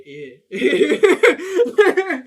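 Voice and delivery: loud, high-pitched garbled imitation breaking into laughter; unintelligible friend. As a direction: imitate a friend's incomprehensible reply, cracking up. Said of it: "you couldn't understand a word he said".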